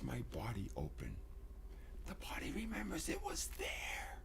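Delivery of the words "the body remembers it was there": high, hushed whisper; softer whispered reminder. Real, not acted. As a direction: whisper it like a secret. Whispering a bodily memory.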